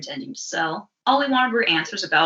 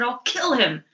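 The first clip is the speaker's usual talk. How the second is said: ordinary talk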